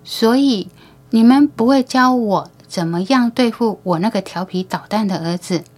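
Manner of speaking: ordinary delivery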